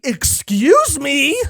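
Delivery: high, slow, stretched disbelief; incredulous excuse me. Real, not acted. Mock disbelief at Koala's description.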